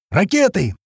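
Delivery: high, urgent alarm call; narrated cry, pitched well up; warning cry. A narrator's reading. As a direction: shout a warning to everyone. Warning cry during rocket attack.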